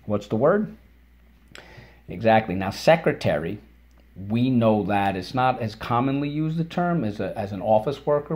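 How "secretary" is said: ordinary talk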